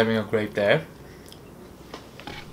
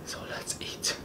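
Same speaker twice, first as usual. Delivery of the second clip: whispering; 16 dB quieter than usual. Real, not acted. About to start eating on camera.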